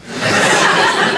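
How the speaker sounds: breathy burst of laughter; laughs. Real, not acted. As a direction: laugh at the joke. Laughing at own joke.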